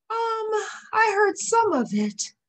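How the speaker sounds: high, light lion cub voice; young cub voice. A narrator's reading. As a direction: sound like a small sleepy cub. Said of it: "Simba replied".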